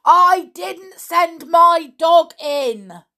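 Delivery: very loud, high, slow bellow. A narrator's reading. Peter bellowing back at the deaf caretaker. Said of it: "Peter bellowed back".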